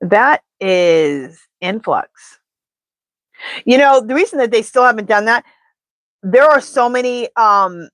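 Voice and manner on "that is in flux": slow oracle answer. Acted.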